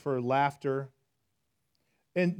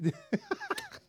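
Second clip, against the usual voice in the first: awkward laugh; 5 dB quieter than usual. Real, not acted. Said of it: "I'm awkward".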